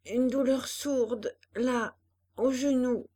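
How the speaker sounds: slow, pained, strained words. A narrator's reading. Hélène answering the doctor after her fall, slow pained voice. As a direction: push each word out through the pain. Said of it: "dit-elle péniblement".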